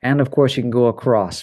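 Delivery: ordinary talk, nothing marked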